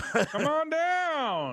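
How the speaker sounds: very high, slow yodeled call; Price is Right yodel. Retold or imitated. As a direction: stretch it out like the game-show yodel. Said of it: "yodeling thing on The Price is Right".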